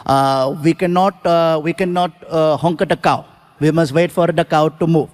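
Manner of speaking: mock Indian driver. Driver's reply voiced.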